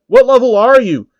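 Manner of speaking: high, loud disbelieving question; stunned challenger; shocked. Acted. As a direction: gasp out the question. Player shocked at level.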